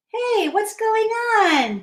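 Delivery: high, drawn-out hail; curious call. Acted. Hippo comes upon the jumpers, high slow call. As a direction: stretch out a high, puzzled call.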